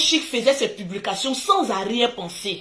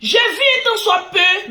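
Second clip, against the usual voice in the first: loud; 9 semitones higher, 5 dB louder, slower than usual. Real, not acted. Sudden loud exclamation.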